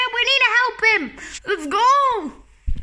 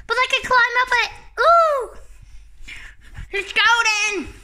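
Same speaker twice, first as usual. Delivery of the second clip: ordinary talk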